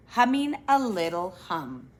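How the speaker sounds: usual speaking voice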